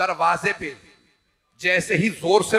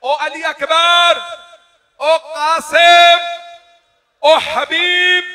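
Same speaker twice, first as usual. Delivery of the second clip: slow, high drawn-out cries of each name, much louder; calling names; 11 semitones higher, 13 dB louder, much slower than usual. Acted. Imam calling his fallen companions by name, drawn-out cries. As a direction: cry each name out long and loud. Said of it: "फिर मौला ने नाम लिए".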